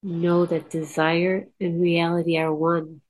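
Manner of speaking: soft, unhurried meditation voice; guided meditation. Acted. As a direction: lead the listeners calmly inward.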